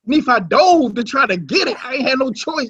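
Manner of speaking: usual speaking voice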